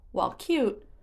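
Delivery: ordinary delivery, nothing marked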